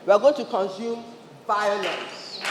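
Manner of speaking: normal talking voice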